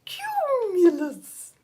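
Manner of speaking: high, drawn-out swooping voice; silly cloud voice. Shown as a demonstration. Teacher acting out a cumulus cloud, silly high slow voice. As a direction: stretch it out high, sliding down at the end.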